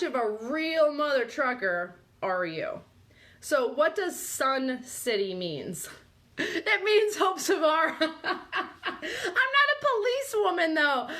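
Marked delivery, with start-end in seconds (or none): 6.4-11.1 high, laughing joke; cracking up. Real, not acted. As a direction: squeal it out through real laughter. Joking about herself, cracking up.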